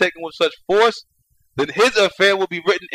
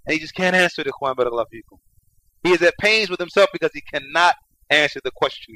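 usual speaking voice

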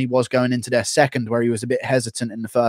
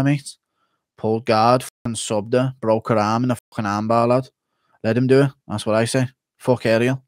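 Paddy Pimblett impression; 2 semitones lower than usual.

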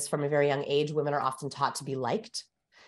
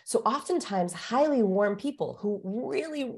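ordinary talk

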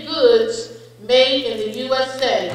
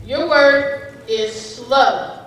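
slow pronouncer voice; much slower than usual. Acted. Spelling bee pronouncer reading word.

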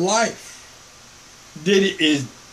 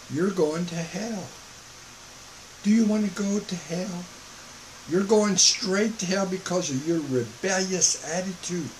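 calm, quiet warning; 7 dB quieter than usual. Shown as a demonstration. Preacher calmly warning sinners of hell, softly. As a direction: say it gently, like a calm reminder. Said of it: "I'm gonna say it calmly".

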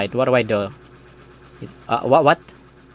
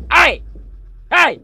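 shouting; 3 dB louder than usual. Real, not acted. Shouting at the jump scarer.

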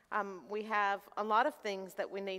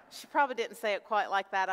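ordinary talk

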